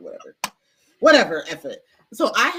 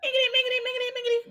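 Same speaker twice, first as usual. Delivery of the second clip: much higher sing-song calling of the nickname; teasing call; faster than usual. Retold or imitated. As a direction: chant the nickname playfully, way up high. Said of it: "they called me Mingiri".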